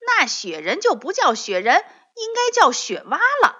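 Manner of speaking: loud, jumping very high on 雪蛙. A narrator's reading. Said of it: "提高了嗓门".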